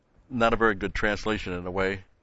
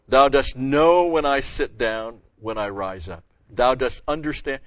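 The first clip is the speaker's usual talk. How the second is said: slow, louder reading of the psalm; slow scripture reading; 5 dB louder than usual. Acted. Preacher reads the psalm slowly to his hearers, with weight. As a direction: let each phrase of the verse land.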